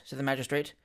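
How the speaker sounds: plain reading voice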